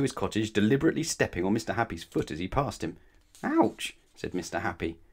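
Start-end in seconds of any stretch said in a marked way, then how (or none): 3.4-4.0 very high storybook "ouch"; yelp of pain. A narrator's reading. Mister Happy with his foot stepped on, yelping.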